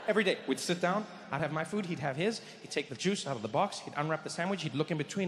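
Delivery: ordinary talk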